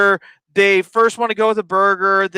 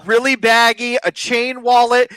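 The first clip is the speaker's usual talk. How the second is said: ordinary talk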